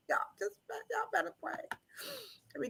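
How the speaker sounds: quick whisper. Real, not acted. Warning the chat to pray, quick whisper. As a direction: hiss it fast.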